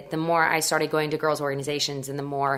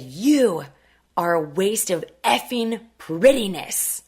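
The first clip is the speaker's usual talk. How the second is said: slow, contemptuous put-down, lightly voiced; scornful agent; 3 semitones higher, much slower than usual. Talent agent scolding the teen who turned him down, slow scorn.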